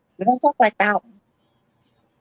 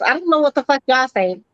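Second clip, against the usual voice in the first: usual speaking voice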